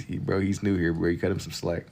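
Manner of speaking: usual speaking voice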